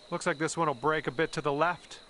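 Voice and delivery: ordinary delivery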